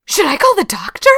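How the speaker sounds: very high, hammed-up gasping disbelief; squeaky-high teasing alarm; taunting mock shock. A narrator's reading. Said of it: "at the familiar taunting".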